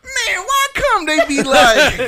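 high, loud, slow mock Obama voice with a laugh; goofy over-the-top Obama bit; Obama impression. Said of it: "Let's hear your Obama impression".

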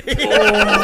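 loud burst of real laughter; hearty laugh. Real, not acted. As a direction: laugh out loud at your own story. Laughing after punchline.

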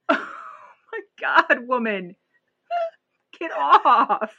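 laughing exasperation. Real, not acted. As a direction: crack up while begging her to get off. Laughing at panicking girl.

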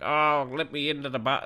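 a hint of a drunk seventy-year-old; drunk old man. Old drunk asking to get in, lightly imitated. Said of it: "this guy's seventy-year-old voice".

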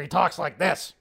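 lightly voiced ranting host; mild conspiracy-host voice; Alex Jones. Shown as a demonstration. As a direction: give a hint of the broadcaster's impression. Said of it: "That's Alex Jones".

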